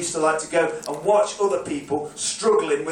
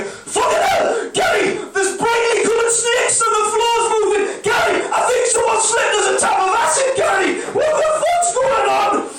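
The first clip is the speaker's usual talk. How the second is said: panicked shriek; 6 dB louder than usual. Retold or imitated. Drunk punter shrieks at Gary about snakes and acid. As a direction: scream as if drugged.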